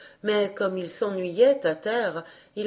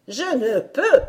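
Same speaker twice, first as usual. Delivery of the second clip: fairy's protest; 6 semitones higher, 6 dB louder than usual. A narrator's reading. Fairy admits failure.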